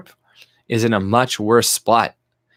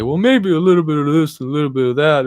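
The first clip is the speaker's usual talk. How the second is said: higher, louder wishy-washy whine; mocking centrist; 5 semitones higher, 5 dB louder than usual. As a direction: parody a hedging moderate. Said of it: "middle ground bullshit that people like to play".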